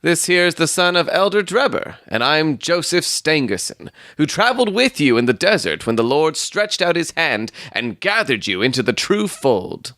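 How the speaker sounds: high, preachy suitor's voice; self-important young suitor. Acted. Stangerson reminding Ferrier of the desert, high and pious. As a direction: lift the pitch and speak piously.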